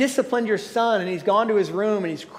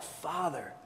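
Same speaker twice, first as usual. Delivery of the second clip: hushed, low, tender Father; soft plea; soft cry of Father; 5 semitones lower, 14 dB quieter than usual. A narrator's reading. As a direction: breathe it out like a child calling his father. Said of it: "causes us to cry out".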